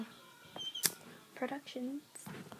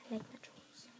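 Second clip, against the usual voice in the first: whispering; 2 semitones lower, 11 dB quieter than usual. Real, not acted. Whispered aside.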